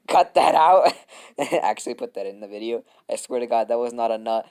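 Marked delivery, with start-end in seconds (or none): 0.1-0.9 louder and drawn out, laughing; louder, slower command. Real, not acted. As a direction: stretch it out and push it louder.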